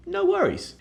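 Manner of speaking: very high, airy reassurance; high breezy no worries. Shown as a demonstration. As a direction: float it off lightly. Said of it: "a very British way to say".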